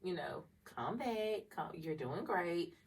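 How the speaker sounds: soft, slow self-soothing; quiet calming pep talk to herself; self-reassurance. Retold or imitated. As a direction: talk yourself down gently. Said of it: "I kinda be like".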